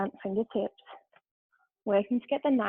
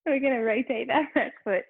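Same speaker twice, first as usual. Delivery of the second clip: higher voice, just recovering from laughter; giggly; 4 semitones higher than usual. Real, not acted. Yoga teacher resuming the class, still giggly. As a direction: push the instruction out past a giggle. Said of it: "I have the giggles".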